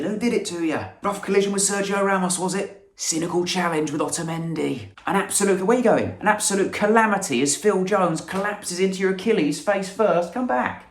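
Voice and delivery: high, frantic mock commentary; frantic commentator. Imagining the player hurt on the stairs, hammed-up panicked commentary. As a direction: call the injury like a panicking commentator.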